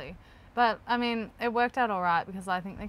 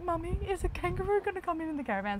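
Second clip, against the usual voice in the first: lightly voiced child; 10 semitones higher, faster than usual. Kids quoted asking.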